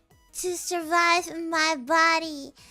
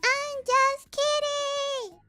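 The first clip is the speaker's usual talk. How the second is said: playful high exclaim; 8 semitones higher, slower than usual. Real, not acted.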